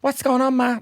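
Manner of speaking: put-on high-pitched little boy with a Dublin accent. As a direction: pipe up as a Dublin kid to his mum. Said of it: "came home with a Dublin accent".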